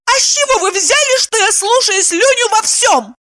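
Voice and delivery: very high indignant shriek; furious wail. A narrator's reading. Wife told her husband would disapprove, furious wail. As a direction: shriek back, mortally offended. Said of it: "завопила она".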